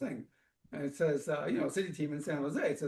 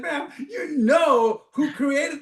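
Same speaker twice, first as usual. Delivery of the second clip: words spilling through laughter, louder; laughing while talking; 8 semitones higher, 8 dB louder, much slower than usual. Real, not acted. Answering the caller on air, laughing through the words. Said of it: "starts laughing".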